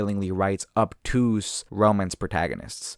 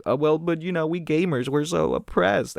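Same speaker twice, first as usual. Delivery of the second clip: higher, whiny self-pitying gamer; 7 semitones higher than usual. Retold or imitated. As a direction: ham up the oppressed gamer. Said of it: "and he's like".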